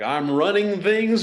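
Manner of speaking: I'm-in-charge tone. Retold or imitated. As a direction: announce you run the place, slowly. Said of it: "vocal inflection".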